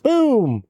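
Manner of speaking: high boom falling steeply; imitated blast. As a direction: hit the boom high and let it drop. Imitates the blast in favorite part.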